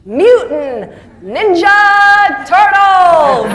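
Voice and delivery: big reveal. Retold or imitated. Speaker revealing his four words, loud slow grand reveal. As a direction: roll out each word like a grand finale. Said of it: "he continued on to go".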